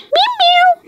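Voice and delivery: very high little meows; kitten meowing. Acted. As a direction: squeak it like a tiny kitten. Cat answers the girl.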